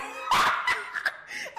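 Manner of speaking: squealing laughter, very high; high shrieking laugh. Real, not acted. Laughing mid-story.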